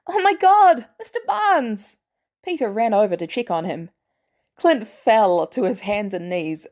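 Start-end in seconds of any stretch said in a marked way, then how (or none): 0.1-2.0 put-on high, loud shocked teen cry; shocked, high cry, slowed on the name; loud gasp of shock. Peter spotting unconscious Bucky, high shocked cry. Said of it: "loudly gasped".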